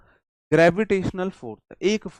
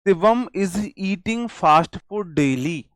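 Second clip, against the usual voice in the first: slow dictation; 3 semitones higher, much slower than usual. Shown as a demonstration. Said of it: "okay".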